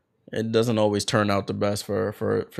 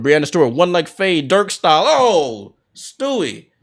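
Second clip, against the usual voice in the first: brisk live game call, names punched; play-by-play; 4 semitones higher, 6 dB louder than usual. Acted. Commentator calling the game for viewers, in lively play-by-play.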